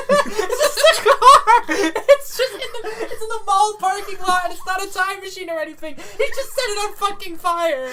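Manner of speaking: high-pitched talk through laughter; really cracking up mid-sentence; laughing. Real, not acted. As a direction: barely get it out for laughing. Helpless laughing at own bit.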